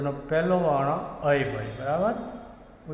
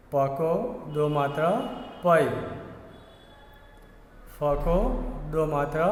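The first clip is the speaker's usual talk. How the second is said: recitation drill. Shown as a demonstration. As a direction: chant the letters like a teacher leading a class. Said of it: "teacher leads recitation drill".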